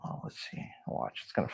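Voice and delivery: hushed whisper. Real, not acted. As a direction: whisper it, concentrating. Whispered while trying a trick.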